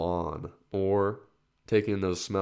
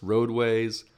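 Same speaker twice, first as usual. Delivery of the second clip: ordinary talk, nothing marked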